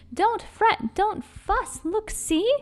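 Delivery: high, soft comforting mouse. A narrator's reading. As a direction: soothe the bear in a tiny high voice. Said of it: "Mouse speaks".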